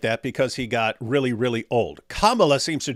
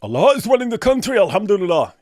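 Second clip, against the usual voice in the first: hammed-up devout praise, higher and louder; mock pious voice; 7 semitones higher, 6 dB louder than usual. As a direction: play an over-the-top pious believer.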